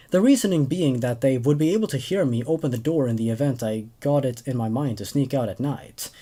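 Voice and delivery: plain reading voice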